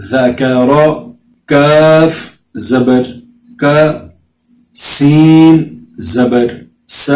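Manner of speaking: slow, clear qaida spelling chant; measured teacher recitation; slow syllable drill. Shown as a demonstration. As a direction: spell each letter and vowel out for the children.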